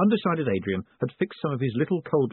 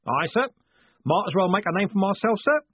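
higher, lively driver's voice; game coachman; 9 semitones higher than usual. Acted. Coachman answering his passenger as they rattle along, high lively voice. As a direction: play an obliging, cheeky coachman.

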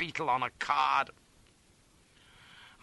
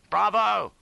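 loud, high shout of triumph; high, loud cheer; exultant cry; 10 semitones higher, 8 dB louder than usual. A narrator's reading. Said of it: "cries I".

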